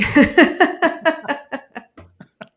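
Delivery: high, loud burst of laughter; real laugh. Real, not acted. Laughing over friend's farewell notes in the storm, amused.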